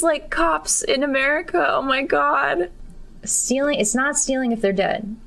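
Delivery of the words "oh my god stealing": ordinary talk, nothing marked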